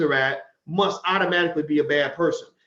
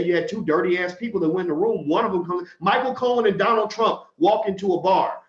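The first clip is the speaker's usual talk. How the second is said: ordinary talk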